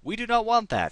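sharp high alarmed cry of no; startled protest at a bad outcome. Real, not acted. Alarmed at being robbed.

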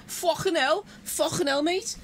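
screaming exaggerated British accent; strongest accent shouted. Shown as a demonstration. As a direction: yell it in the thickest British accent you can. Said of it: "with the strongest accent".

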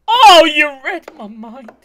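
loud, high, slow exclamation; delighted outburst. Real, not acted. Reactor sees the other side stocked, delighted shout. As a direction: belt it out, stretching the words.

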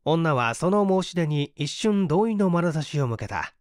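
plain reading voice

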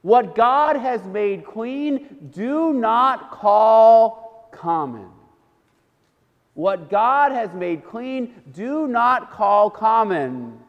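very slow, measured pronouncement; God. Acted. God correcting Peter in his dream, slow solemn decree. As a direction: spread the words out with weighty pauses. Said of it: "the voice says to him".

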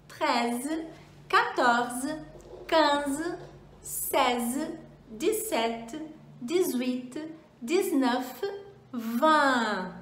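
onze to vingt each said separately, clearly, with a rising tune; French numbers demo. Shown as a demonstration. Teacher models onze to vingt for students to repeat. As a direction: model the teens in careful French. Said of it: "Vamos fazer agora do onze ao vinte?".

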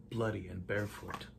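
ordinary delivery

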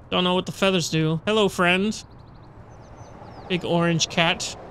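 ordinary talk, nothing marked

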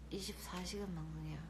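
ordinary delivery

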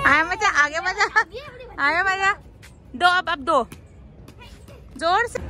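excited cheering. Real, not acted. Cheering during ball game.

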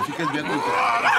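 high, loud laughing outburst; mocking shout. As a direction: shout it in disbelief. Mocking a dumb request.

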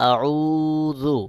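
আউযু with the উ drawn out; আউযু: long held vowel; one-alif lengthening. Shown as a demonstration. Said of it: "এক আলিফ টান দিয়ে উচ্চারণ করতে হবে".

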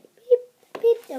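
high loud mock beeps; beep sound effect. Kid voicing her magic powers, loud high beeps. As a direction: squeal out a pretend magic beep.

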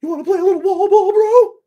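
very high, loud squeaky call; John Powers wall ball voice. Retold or imitated. Imitating John Powers inviting him to wall ball, very high and loud. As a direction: shriek the invitation up high like a cartoon. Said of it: "he'd hit me with".

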